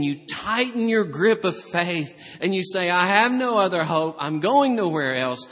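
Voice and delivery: loud, forceful sermon climax; impassioned preaching. Real, not acted. Preacher urging believers to cling in faith, impassioned delivery. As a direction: drive each declaration home with rising fervour.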